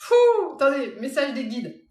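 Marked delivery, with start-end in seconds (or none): 0.0-0.1 high breathless giggle; collapsing into giggles; laughing. Real, not acted. Medium giggling uncontrollably at the name.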